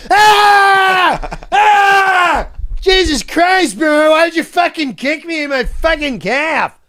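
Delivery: high, loud yelping cry of pain; pained yell. Retold or imitated. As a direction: howl like you just got kicked in the calf. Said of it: "And he goes".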